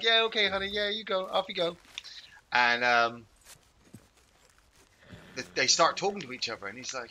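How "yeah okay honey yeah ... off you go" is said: higher-pitched husband humouring his wife; mock-eager send-off to a wife; agreeable husband. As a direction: play the husband waving her away. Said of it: "the husband's like".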